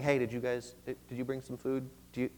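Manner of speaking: lightly acted near-whisper, halting; hushed whispering. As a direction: ask around discreetly under your breath. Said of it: "like, whispering to themselves".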